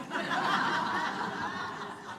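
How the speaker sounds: quiet breathy chuckle; soft laugh. Real, not acted. Amused at her own remark about her husband, quiet laugh. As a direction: let a soft laugh slip out.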